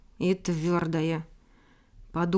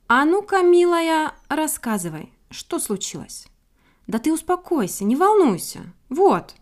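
put-on lively, higher and louder old-friend voice; raised voice, easing down as you reassure; kindly watchdog; 8 semitones higher, 5 dB louder than usual. A narrator's reading. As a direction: coax and settle a flustered little bird. Voices kindly watchdog soothing the bird.

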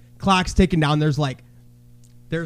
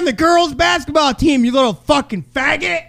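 mock taunting yell; 9 semitones higher than usual. Parent taunting the boy across the court. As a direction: taunt him across the gym. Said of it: "That's what I wanted to say".

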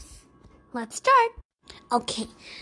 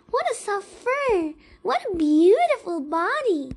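mouse's delighted admiration; admiring; 6 semitones higher than usual. A narrator's reading. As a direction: marvel at it with raised pitch.

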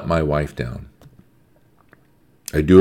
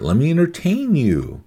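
high, singled-out sales pitch of a tempter; tempting world; 9 semitones higher than usual. Acted. The world luring believers from church, mock enticement. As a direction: coax them with a high, swooping voice. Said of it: "this world wants to keep you ignorant".

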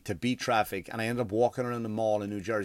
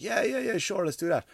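high-pitched, hurried-to-please yeses; mock eager agreement; 10 semitones higher than usual. Man agrees to let him eat first, mock eager agreement. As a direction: imitate someone agreeing, pitched well up.

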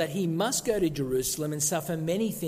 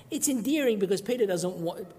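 ordinary talk, nothing marked

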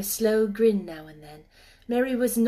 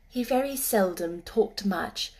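ordinary delivery

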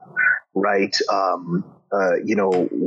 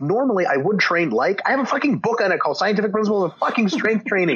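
much higher, heated exasperation; exasperated rant; 10 semitones higher than usual. Real, not acted. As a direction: vent at the clueless commenters. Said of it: "exasperated rant at commenters".

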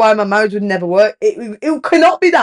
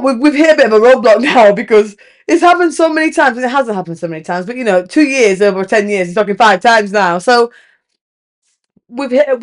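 ordinary delivery

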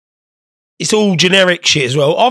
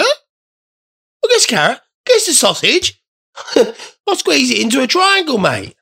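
mock video guy; 8 semitones higher, slower than usual. Mocking the carrot video guy.

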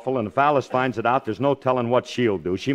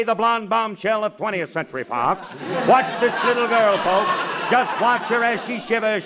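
high, rhythmic tour-guide patter; sideshow barker spiel; 6 semitones higher than usual. Acted. Husband showing tourists his movie-star wife, carnival barker spiel. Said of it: "wrong spiel".

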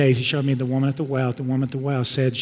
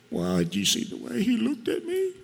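much higher, quieter put-on whine; mock complaining spouse; 8 semitones higher, 6 dB quieter than usual. Spouse complaining about a look they got, mock-offended. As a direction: play the wounded complainer in a small high voice.

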